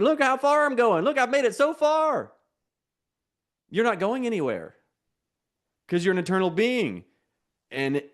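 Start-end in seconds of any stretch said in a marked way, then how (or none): 0.0-2.3 pitched well up, proud and pleased with itself; mock boastful self. Preacher mocking the listener who thinks he's getting somewhere, high and smug. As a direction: brag like someone sure they've arrived. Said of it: "You think".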